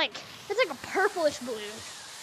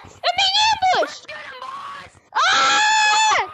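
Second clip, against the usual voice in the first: high, loud panicked yelling; fluttering cries for help; 10 semitones higher, 5 dB louder than usual. Real, not acted. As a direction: shout for help while fleeing. Fleeing attackers in game.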